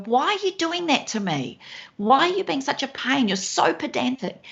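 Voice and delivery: high, whiny accusing tone; mock-accuse her husband of being a pain; exasperated complaint. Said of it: "now I could look at that and go".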